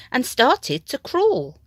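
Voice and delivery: plain reading voice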